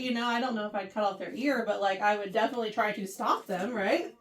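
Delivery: normal talking voice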